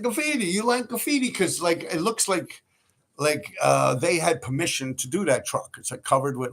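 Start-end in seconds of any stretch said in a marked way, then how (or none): 0.0-1.3 put-on higher, teasing French accent on Graffiti, you like graffiti; mock French accent. Mocking the French truck owner in a French accent. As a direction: echo the owner's French accent back at him. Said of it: "But he talked with, like, a French accent".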